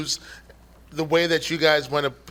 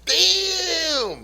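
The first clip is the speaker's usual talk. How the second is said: gawking bystander bit; 12 semitones higher than usual. Acted. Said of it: "Poop was just doing bit right there".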